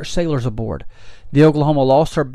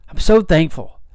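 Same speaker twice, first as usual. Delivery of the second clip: louder, fervent declaration; proclaimed gratitude; 3 semitones higher, 4 dB louder than usual. Real, not acted. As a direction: proclaim your thanks to the congregation.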